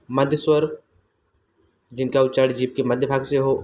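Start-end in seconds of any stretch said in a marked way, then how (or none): none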